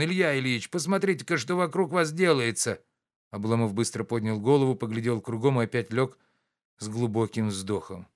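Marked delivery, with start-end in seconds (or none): 0.0-2.8 high, alarmed cry; испуганно. A narrator's reading. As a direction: scare the master awake. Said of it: "испуганным голосом". (слуга в испуге зовёт барина)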